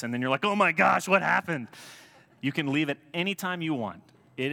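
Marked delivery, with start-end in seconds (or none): 0.4-1.7 high, loud startled outburst; mock shock. New churchgoer suddenly hearing about Jesus, mock startled. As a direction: exclaim it in exaggerated alarm.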